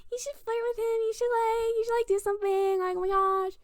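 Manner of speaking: put-on high, squealy girlfriends egging her on; giddy, high-pitched mock teasing; teasing friends. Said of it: "teasing me".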